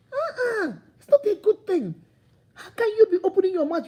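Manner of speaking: shocked high-pitched reproach; high scolding disapproval. Real, not acted. Scolding a careless talker.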